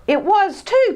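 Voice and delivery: high, loud angry cry; angry retort. Acted. Sid angrily blaming Ted. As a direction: snap back at him. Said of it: "cried Sid angrily".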